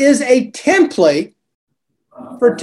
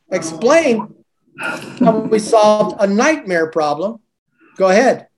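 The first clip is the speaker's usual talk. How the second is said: usual speaking voice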